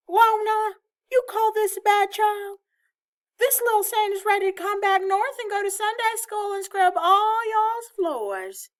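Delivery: loud, high-pitched holler of a grandmother; shouting. A narrator's reading. Grandmother on the phone to her daughter after winning the battle, shouting. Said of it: "we'd have to hold the phone away from her ear while she shouted".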